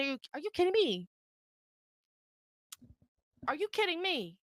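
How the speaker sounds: high, hushed disbelief; quiet, high-pitched incredulity; incredulous. Retold or imitated. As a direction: softly ask in stunned outrage. Acted incredulous confrontation.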